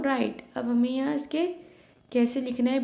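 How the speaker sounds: usual speaking voice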